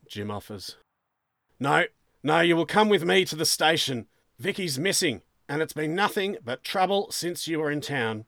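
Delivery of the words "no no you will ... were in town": loud, higher, forceful sergeant; angry order. Acted. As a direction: order the suspect to the station. Sergeant orders suspect to station.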